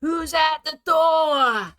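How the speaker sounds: "Howard, who's at the door?" yelled high and slow, the way his mother calls; a high-pitched mother hollering through the house; shrill mum yell. Retold or imitated. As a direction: mum calling her son from another room, high and drawn-out. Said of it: "She's like".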